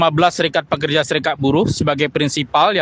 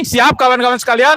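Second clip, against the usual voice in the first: loud call to the crowd; rallying call; 6 semitones higher, 4 dB louder than usual. Real, not acted. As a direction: fire up the audience. Rousing the crowd. (seruan lantang ke massa)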